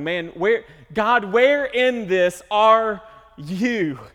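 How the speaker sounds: loud, high, drawn-out plea; slow desperate prayer voice; anguished cry to God. Acted. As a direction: cry out slowly to God as if lost.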